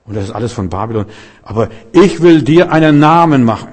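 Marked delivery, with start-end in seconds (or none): 1.4-3.7 God's promise, louder and higher; proclaiming loudly. Acted. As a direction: declare it with full force. (Gottes Verheißung, laut verkündet)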